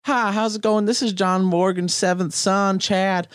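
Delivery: high-pitched, hammed-up caller voice; pretend lawyer's son. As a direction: play a powerful man's son making a prank call. Plays Morgan's son on prank call.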